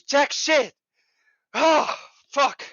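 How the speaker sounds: high, drawn-out, anguished frustration; exasperated venting. Real, not acted. Listener venting about a pointless session, high exasperated wail. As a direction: stretch it out slowly, then groan the curse.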